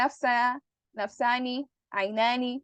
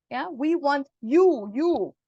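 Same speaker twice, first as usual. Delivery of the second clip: slow, you repeated firmly; stress on you; 3 semitones higher, 4 dB louder, slower than usual. Shown as a demonstration. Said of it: "emphasis being created".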